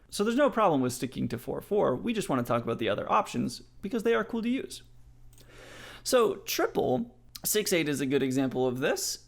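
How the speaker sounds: normal talking voice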